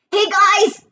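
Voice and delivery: high-pitched holler; high, excited shout; yelled from above. A narrator's reading. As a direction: call down to friends far below. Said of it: "Grover yelled somewhere above us".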